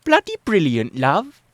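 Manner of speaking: loud, high-pitched mock British accent. A narrator's reading. Clara repeats herself for Mike, in a British accent. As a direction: exaggerate the British accent with relish. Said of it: "Then, in a British accent".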